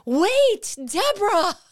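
high, loud call after her like a film hero; romantic movie call. Retold or imitated. Man running down the hallway calling her back, hammed-up movie style. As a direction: cry out her name as in a love story. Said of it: "a scene from, like, a romantic movie".

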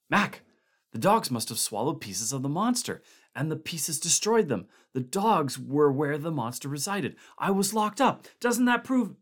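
high, urgent pleading; excited pleading. A narrator's reading. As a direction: push it up, desperate.

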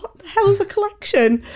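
high-pitched bewildered; high panicked. Retold or imitated. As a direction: squeak it in disbelief. Retold panic.

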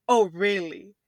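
rising annoyed oh really; exasperated disbelief. Retold or imitated. Exasperated at door handle.

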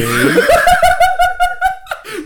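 a high, loud shrieking laugh; loud burst of laughter. Real, not acted. Reacting to his friend's overdone delivery, a loud shrieking laugh. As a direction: crack up in a squealing burst of laughter.

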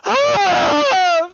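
high, loud wail from off; mother's loud high wail from another room; distant wail. Acted. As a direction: cry out in high grief. Mother wailing from other room.

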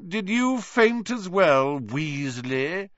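high, loud, drawn-out jeer; loud taunting Malfoy. A narrator's reading. Malfoy taunting Weasley about fainting, loud jeer. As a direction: crow it slowly, lifting at the end. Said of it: "said Malfoy loudly".

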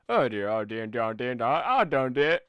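loud gibberish narration imitation; mock narrator rambling in slurred nonsense; distant narrator voice. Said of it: "a distant voice narrating my life".

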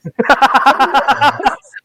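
high burst of laughter; loud laughter. Real, not acted. Big laugh at the joke.

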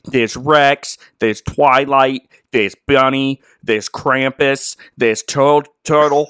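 put-on Boston dog owner; imitated Boston accent. Connecticut man naming his hundred dogs, imitated Boston accent. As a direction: list them off in a mock Boston accent. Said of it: "that's what he sounded like".

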